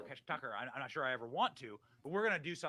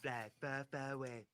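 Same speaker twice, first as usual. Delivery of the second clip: Donald Trump; 2 semitones lower, 6 dB quieter than usual. Acted. Host reading the post aloud as the former president. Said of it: "I'm gonna read this in Donald Trump voice".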